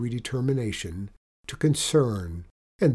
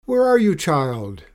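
high, loud call dropping on the last word; 5 semitones higher, 9 dB louder than usual. A narrator's reading. Mother dragon searches for Benny, calling out loudly. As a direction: holler for a missing child. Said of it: "the voice called".

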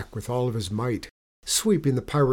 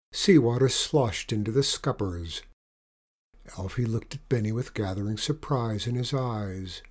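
ordinary delivery, nothing marked

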